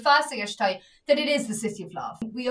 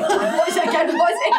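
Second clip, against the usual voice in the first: climbing much higher and louder, laughing; laughing panic; 6 semitones higher, 7 dB louder than usual. Retold or imitated. Asking her brother again: high, laughing, flustered. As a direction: squeal it out in giggly panic. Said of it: "I was like".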